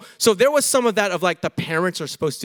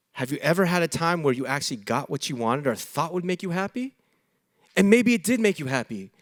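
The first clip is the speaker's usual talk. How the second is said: usual speaking voice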